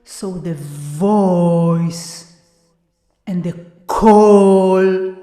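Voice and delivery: "voice" and "call" drawn out slowly; stretched O vowels. Shown as a demonstration. Teacher slowly stretching the O in "voice" and "call". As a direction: linger on the O sound. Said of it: "they both have this vowel".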